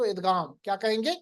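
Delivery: ordinary delivery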